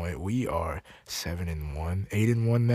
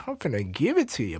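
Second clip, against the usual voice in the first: ordinary talk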